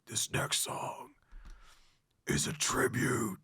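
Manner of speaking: slow growled dedication to the crowd; death metal voice. Retold or imitated. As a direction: announce it in a guttural metal growl. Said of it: "death metal voice".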